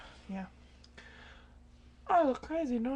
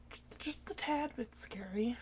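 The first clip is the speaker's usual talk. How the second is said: whisper; 8 dB quieter than usual. Real, not acted. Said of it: "That was kinda scary".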